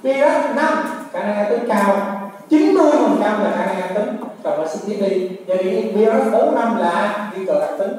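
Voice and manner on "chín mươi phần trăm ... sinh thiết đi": ordinary delivery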